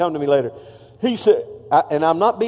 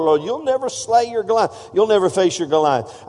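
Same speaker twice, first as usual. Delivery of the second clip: ordinary talk, nothing marked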